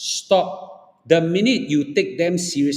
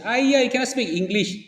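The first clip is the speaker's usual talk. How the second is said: high-pitched, quick imitation of a scoffer; mock dismissive whine, pitched up fast; mocking complaint; 8 semitones higher, faster than usual. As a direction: mimic someone sneering at another's English. Acting people who look down on accents.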